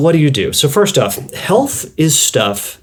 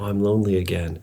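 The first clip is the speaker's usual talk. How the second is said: softer, sulky little complaint; mock lonely mumble; 3 semitones lower, 8 dB quieter than usual. Shepherd boy grumbling to himself, soft sulky mumble. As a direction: sigh it out like a bored lonely kid. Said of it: "And then he says".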